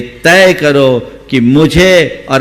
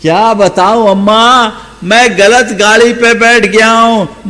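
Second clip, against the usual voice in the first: higher, louder despairing wail; louder lament; 8 semitones higher than usual. Shown as a demonstration. Preacher replays the young man's confession to the old woman, louder and despairing. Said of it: "repeated for emphasis".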